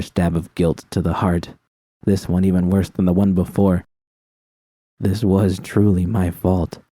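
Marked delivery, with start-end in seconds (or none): none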